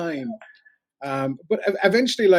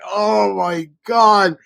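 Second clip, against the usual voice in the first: mock horror; 5 semitones higher, 7 dB louder, much slower than usual. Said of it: "And I was like".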